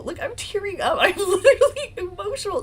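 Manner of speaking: emotional laugh. Real, not acted. To viewers about the lawyers' skill, emotional laughing. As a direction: laugh while welling up. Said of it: "I'm, like, getting emotional".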